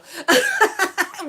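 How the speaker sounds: high, loud cackle at own joke; big high-pitched laughing fit; burst of laughter. Real, not acted. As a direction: crack up loudly.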